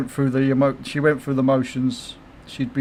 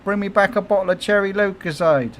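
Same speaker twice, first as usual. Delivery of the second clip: wife's demand, pitched up; a higher mock wife voice; 6 semitones higher than usual. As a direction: imitate his wife, higher, pleading. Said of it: "she'd go".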